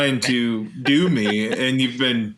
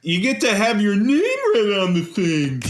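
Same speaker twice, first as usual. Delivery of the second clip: higher cartoon-style read; vintage cartoon voice; 7 semitones higher than usual. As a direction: try the cartoon voice.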